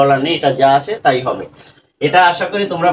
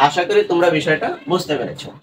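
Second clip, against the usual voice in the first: normal talking voice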